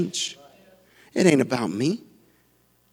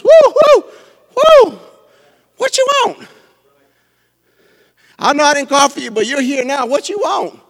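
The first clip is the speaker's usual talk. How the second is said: high whooping king, playful then casual; smitten king; 11 semitones higher, 8 dB louder than usual. Retold or imitated. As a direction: whoop at her like a charmed king. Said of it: "the king takes notice".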